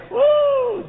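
high, loud preacher's whoop; sudden ringing "woo"; excited whoop. Real, not acted. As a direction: let out a joyful whoop. Preacher whoops mid-sermon.

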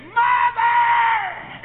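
long high loud call; shout. Retold or imitated. Son shouting to his mother on the phone, long loud cry. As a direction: holler it down the line.

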